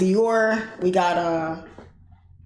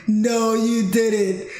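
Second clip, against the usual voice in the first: long, drawn-out mock disbelief; slow incredulous words breaking into a laugh; stunned disbelief; 3 semitones higher, much slower than usual. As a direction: imitate an astonished mom, stretching each word. Mother retold in disbelief at car.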